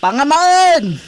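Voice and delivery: excited call. Acted. Calling out to uncle, excited.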